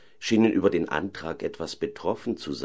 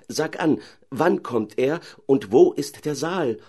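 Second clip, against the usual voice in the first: eager call; 8 semitones higher, 3 dB louder than usual. Acted. Caliph eagerly calls to the owl princess, high pleading cry. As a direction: cry out to her, full of hope. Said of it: "rief der Kalif".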